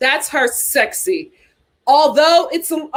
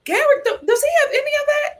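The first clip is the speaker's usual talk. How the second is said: incredulous; 12 semitones higher, faster than usual. Pretend. Mock disbelieving question.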